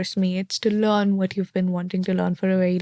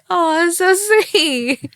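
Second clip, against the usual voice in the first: delighted laugh; 9 semitones higher, 5 dB louder, slower than usual. Retold or imitated. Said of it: "And I'm like".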